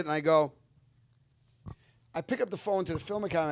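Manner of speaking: ordinary talk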